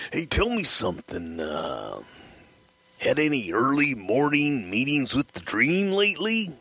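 high, stretched wrestler impression; pry with mock-casual, singsong slowness; Randy Savage. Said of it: "Randy calls Rick Rude".

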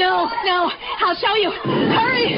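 high, panicky urging; frantic urging. Acted. Wife hurrying husband into the attic, frantic urging. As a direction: urge them on in desperation.

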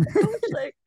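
put-on high, slow, laughing kid voice; imitating a confused kid. Kid asking the coach what went wrong, high laughing voice. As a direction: play a puzzled young player, laughing. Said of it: "Or like".

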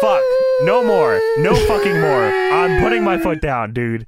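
loud, high, exasperated protest; ranting shout. Real, not acted. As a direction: yell it like you're fed up. Ranting against Sting segments.

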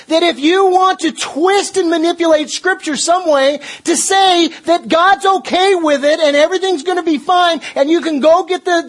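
high, forceful sermon delivery; impassioned preaching. Real, not acted. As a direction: preach it hard, pitched well above normal talk.

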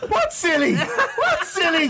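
high, laughing what's silly; squeaky bewildered question; retelling his confusion, high and laughing; mock baffled. Confused, asking what happened.

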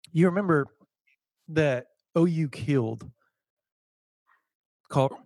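hiccups cutting the sentence off; stopped short by a hiccup. Real, not acted. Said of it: "hiccups are killing me".

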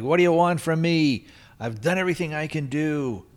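high-pitched exasperated outburst; mad. Retold or imitated. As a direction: act out someone at the end of their rope. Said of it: "and he gets mad".

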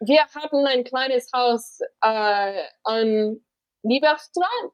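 put-on lines in a German accent, slowly. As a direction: recite the German line with a careful German accent. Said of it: "I can't do the German accent".

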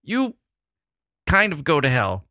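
put-on chirpy Wisconsin accent, jumping high on Oh; a light Wisconsin accent, sweetly saying go to hell. Host imitating a polite Wisconsin brush-off, Wisconsin accent. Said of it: "a very Wisconsin kind of thing to say".

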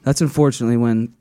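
low, sober mock reporting; imitating a solemn story; serious storyteller. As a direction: tell it gravely, low and even. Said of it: "telling a serious story".

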